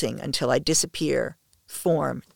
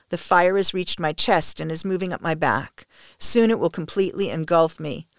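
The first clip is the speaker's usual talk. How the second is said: usual speaking voice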